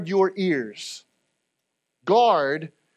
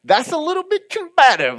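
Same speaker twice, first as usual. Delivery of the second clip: mock objection; 8 semitones higher, 4 dB louder than usual. Preacher voicing a hesitant churchgoer, high-pitched mock objection. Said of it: "and you're like".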